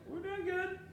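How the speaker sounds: high-pitched polite reply; lightly put-on high voice; imitated audience couple. Voicing the couple answering host.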